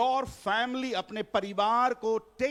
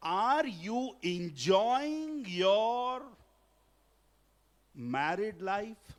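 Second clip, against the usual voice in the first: drawn-out question; much slower than usual. Real, not acted. Pastor asks congregation slowly.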